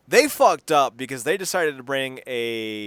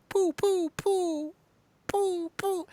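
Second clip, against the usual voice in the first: high, soft mock gunshot noises; 3 dB quieter, much slower than usual. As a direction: pop off little shots. Imitating weak gunfire.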